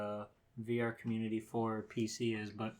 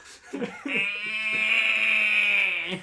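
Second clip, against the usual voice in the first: sudden loud laughing shriek; loud burst of laughter; 9 semitones higher, 11 dB louder than usual. Real, not acted. Jump scare loud laugh.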